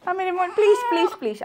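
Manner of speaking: high laughing please. Real, not acted. Pleading with baby.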